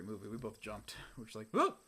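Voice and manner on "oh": short, high mock shouts; startled high-pitched cry; yelled alarm. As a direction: yell a sudden warning in separate bursts. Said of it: "It's like yelling fire in a movie theater".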